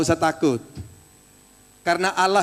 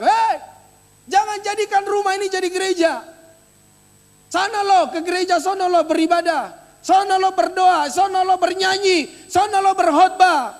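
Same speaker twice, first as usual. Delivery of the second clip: high, raised-voice mock of protesting neighbours; high-pitched scolding shouts, retold; imitated angry shouting; 11 semitones higher, 5 dB louder than usual. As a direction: act out neighbours yelling to drive worshippers away. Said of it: "datang berteriak-teriak".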